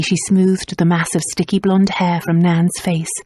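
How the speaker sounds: usual speaking voice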